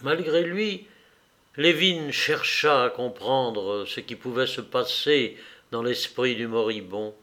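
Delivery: ordinary delivery, nothing marked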